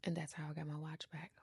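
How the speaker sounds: fast, whispered gloat; hushed triumphant wrap-up; smug whisper. Real, not acted. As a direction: whisper it with quiet satisfaction. Triumphant end of story.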